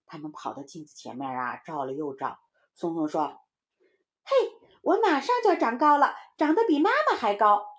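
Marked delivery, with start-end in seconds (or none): 4.2-7.6 put-on high, loud excited kid; boasting little child. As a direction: crow about growing tall. (小孩兴奋地高声夸口)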